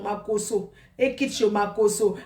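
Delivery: ordinary delivery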